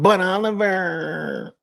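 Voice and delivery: very high, slow mock wail; imitating a tearful doubter; crying naysayer voice. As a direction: whine the name out long like a crybaby. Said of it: "sort of hear them in my mind".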